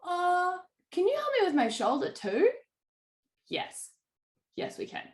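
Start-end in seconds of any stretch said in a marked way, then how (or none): 0.0-2.5 high, small voice asking a favour; hopeful client. Retold or imitated. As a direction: play the client sheepishly adding a request. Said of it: "And then they're like".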